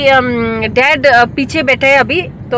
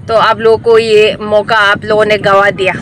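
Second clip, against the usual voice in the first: ordinary talk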